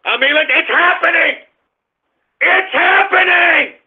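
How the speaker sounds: a host thrilled by the record highs; excited cheer. Acted. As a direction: crow it out to the audience. Celebrating market highs.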